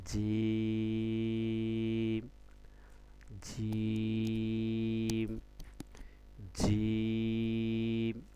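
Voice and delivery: "জিম" held very long on a level note; three-alif stretch. Shown as a demonstration. Teacher modelling three-alif length, each "জিম" hugely drawn out. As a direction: stretch the vowel of "জিম" steadily, then close on m. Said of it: "তিন আলিফ টানতে হবে".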